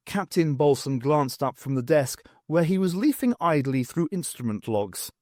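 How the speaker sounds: ordinary delivery, nothing marked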